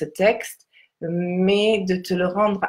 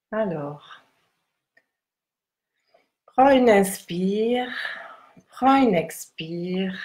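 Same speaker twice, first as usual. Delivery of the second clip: guided meditation. Acted. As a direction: guide them gently into stillness.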